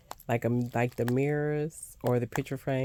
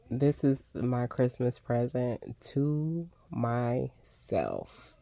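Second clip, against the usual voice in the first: usual speaking voice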